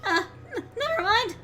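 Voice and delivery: high, stammering, nervous character voice; high-pitched nervous fumbling; flustered game character. Acted.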